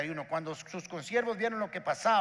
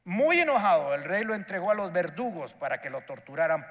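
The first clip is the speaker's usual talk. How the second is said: normal talking voice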